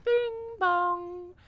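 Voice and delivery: sung two-note chime; ding like a station chime. Acted. Train stop chime announcement.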